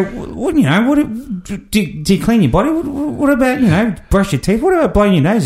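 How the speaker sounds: high, stammering string of questions; retold incredulity. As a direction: grope for words, baffled.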